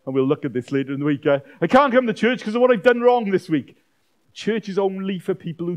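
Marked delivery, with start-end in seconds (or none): 1.6-3.8 high, loud mimicked protest; mock excuse. People excusing themselves from church, mock protest. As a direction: parody someone making a silly excuse.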